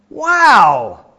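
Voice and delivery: loud, high, drawn-out wow; enthusiastic wow. Acted. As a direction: let the admiration burst out, hammed-up. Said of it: "wow quite with the same enthusiasm".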